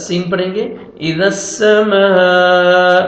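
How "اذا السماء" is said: "السماء" with its long aa held slowly; elongated madd. Shown as a demonstration. Teacher showing the madd rule, "السماء" drawn out. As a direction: stretch the aa of "السماء". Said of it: "مد واجب ہے".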